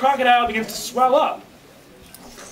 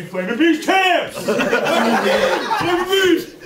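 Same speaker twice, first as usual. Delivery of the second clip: drawn-out, higher-pitched fan cheer; team cheer; 5 semitones higher, much slower than usual. Acted. Player cheers for the Flaming Bees, team cheer. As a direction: stretch the team name into a chant.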